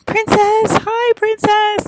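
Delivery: high-pitched, loud, drawn-out greeting; excited squeal. Retold or imitated. Gray aliens greeting their princess, squealing with excitement. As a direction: imitate overjoyed fans squealing in high voices. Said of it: "they're so excited".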